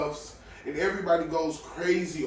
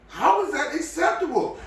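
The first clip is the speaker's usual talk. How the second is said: much higher, louder, in disbelief; outraged exclamation; 13 semitones higher, 5 dB louder than usual. Real, not acted. Podcaster ranting at listeners about an outfit, outraged exclamation. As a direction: throw the question out, appalled.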